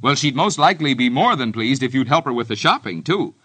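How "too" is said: ordinary talk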